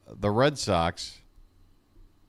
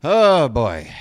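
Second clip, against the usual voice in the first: exasperated; 8 dB louder than usual. Real, not acted. Fan exasperated by stranded runners.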